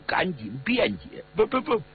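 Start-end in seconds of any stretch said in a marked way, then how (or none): 1.3-1.8 high, stammering self-defence; scared, higher-pitched protest; frightened pleading. A narrator's reading. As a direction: stumble over a panicked denial. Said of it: "吓得面色灰黄啊， 赶紧辩解".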